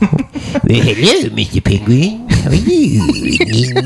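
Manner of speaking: drawn-out silly voice; goofy character voice. Pretend. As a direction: greet the penguin like a cartoon character.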